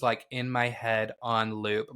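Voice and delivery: normal talking voice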